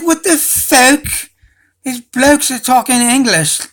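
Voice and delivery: high, drawn-out mock-British exclamation; mock British accent. Mimics the actors' British accent.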